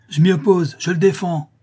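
loud, high protest; scandalized husband crying out; indignant outburst. A narrator's reading. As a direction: forbid it with outraged force. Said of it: "s'écria-t-il".

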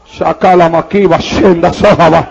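low, slow glossolalia; speaking in tongues. Real, not acted. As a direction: intone the syllables deep and unhurried.

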